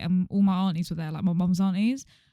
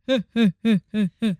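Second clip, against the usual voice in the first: breathy mock huh-huh laugh; imitated lazy laugh; 4 dB louder than usual. Friend mocking his new laugh, lazy huh-huh laugh. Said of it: "it's just a lazy laugh now".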